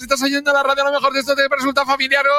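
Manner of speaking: long goal shout. Shown as a demonstration. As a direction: hold the goal cry like a radio commentator. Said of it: "lanzar, por ejemplo, un". (grito de gol de locutor de radio)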